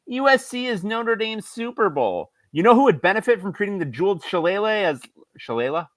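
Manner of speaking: high, whining complaint; whiny voice. Acted. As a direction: read it like a sulky whiner. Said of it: "Whiny voice".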